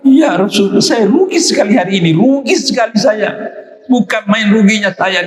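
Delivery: high, tearful companion lamenting to the Prophet; weeping regret. Retold or imitated. A companion weeps to the Prophet over his lost prayer, high and tearful. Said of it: "dia nangis".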